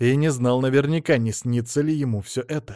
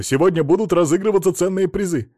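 higher, projected public announcement; TV speech voice; 6 semitones higher, 4 dB louder than usual. A narrator's reading. Businessman promises shoppers prizes on TV, raised announcing voice. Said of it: "его голос, слегка искажённый".

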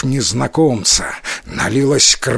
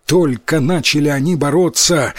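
usual speaking voice

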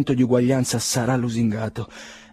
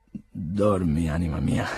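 tender goodnight; 5 semitones lower, 4 dB quieter, slower than usual. Acted. Nikolai bidding sick brother goodnight.

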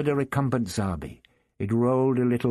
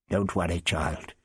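reassuring; 6 semitones lower, 3 dB quieter than usual. Acted. Old doctor comforts the girl.

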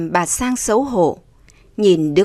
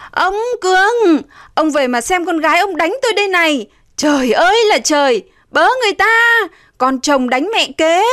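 wailing cry for help; 9 semitones higher, 3 dB louder than usual. A narrator's reading. Beaten stepmother calling her husband, wailing. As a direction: call out in loud distress. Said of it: "Bà vừa khóc, vừa kêu tên ông Cường".

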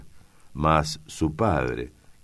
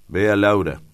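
loud, higher-pitched order; calling out; 5 semitones higher, 8 dB louder than usual. A narrator's reading. Master addressing his one-eyed cook, calling out. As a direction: call to the cook with authority.

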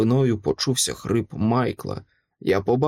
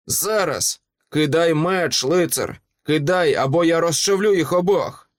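higher-pitched, forceful ultimatum; threatening command; 5 semitones higher than usual. Acted. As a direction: order him to drop it, menacingly. Monster threatens, demanding the sword. (погрозливий наказ)

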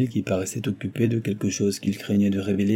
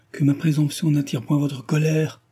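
pleading outcry; 6 semitones higher than usual. Acted. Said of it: "s'écria-t-il".

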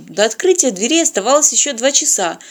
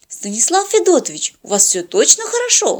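checking in; 6 semitones higher than usual. A narrator's reading. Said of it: "уточнила Полина".

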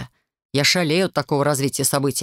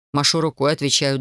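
plain reading voice

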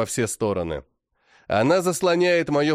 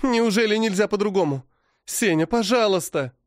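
high, tearful pleading; pleading cry; 7 semitones higher than usual. A narrator's reading. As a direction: she begs her husband not to die. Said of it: "плачет она". (слёзная мольба)

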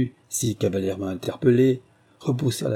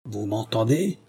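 usual speaking voice

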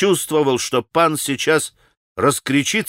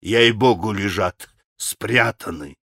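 losing heart; 5 semitones lower than usual. A narrator's reading. Mitya swears the money is hidden, losing heart. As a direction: lower voice, sinking away. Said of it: "труся и падая духом с каждым своим словом".

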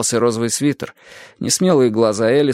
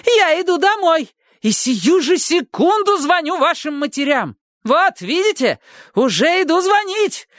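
put-on very high raging old lady; ranting neighbour. A narrator's reading. Neighbour rages at the children, very high voice. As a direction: rant it out. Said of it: "разорялась миссис Фонтейн".

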